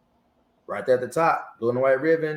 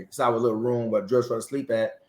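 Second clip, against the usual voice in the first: normal talking voice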